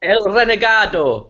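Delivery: high, slow, drawn-out Spanish shout; playful, stretched-out Spanish announcement; mock Spanish announcer. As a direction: proclaim it like a hammed-up Spanish title.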